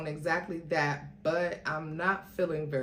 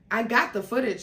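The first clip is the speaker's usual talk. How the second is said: louder, higher disgusted jab; 5 semitones higher, 6 dB louder, faster than usual. Acted. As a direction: spit it out in contempt. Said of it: "With disgust".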